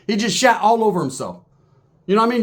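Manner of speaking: ordinary talk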